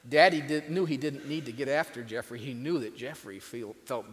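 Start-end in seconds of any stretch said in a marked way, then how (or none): none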